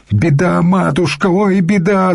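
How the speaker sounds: high-pitched keening cry; keen it high, in despair; wailing lament. A narrator's reading. Young monk brings the matushka bad news, wailing. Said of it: "заголосил монашек".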